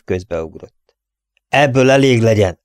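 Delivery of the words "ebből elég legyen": loud, firm sheriff's command; stern order. Acted. Sheriff stopping a gunfight, loud stern order. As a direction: shut the quarrel down hard.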